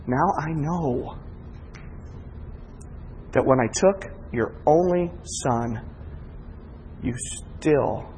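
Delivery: soft, low and slow; hushed divine tenderness; God's tender voice. Acted. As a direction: murmur it like a quiet revelation. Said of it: "God said".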